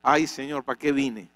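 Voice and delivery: normal talking voice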